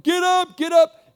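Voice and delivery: high, loud frantic call to wake him; panicked wake-up call. Retold or imitated. Disciples shaking Jesus awake in the storm, high frantic shout.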